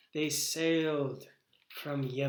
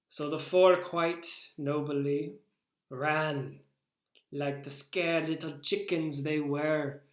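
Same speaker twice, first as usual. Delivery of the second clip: normal talking voice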